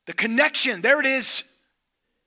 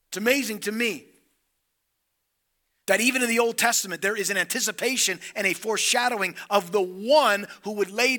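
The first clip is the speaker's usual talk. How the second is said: louder, rising fervent preaching; 4 semitones higher, 12 dB louder than usual. Acted. Preacher marvelling at redemption, loud and fervent. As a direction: build it up with conviction.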